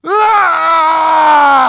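high, loud, hammed-up wail of a scowling child; mock toddler outburst. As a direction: throw a fake tantrum cry. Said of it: "he goes".

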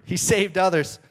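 religious leaders mocking the man on the cross; mockery. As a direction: jeer like smug leaders. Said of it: "joined in the mockery".

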